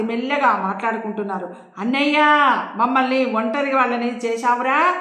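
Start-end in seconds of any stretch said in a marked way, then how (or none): none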